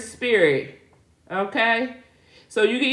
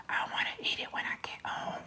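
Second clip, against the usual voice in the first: shy whisper; 16 dB quieter, faster than usual. Retold or imitated. Shy niece imitated in car.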